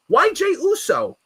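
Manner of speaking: high, loud disbelieving cry; outraged why. Real, not acted. Fan ranting at the booking, high and incredulous. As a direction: demand an answer, incredulous.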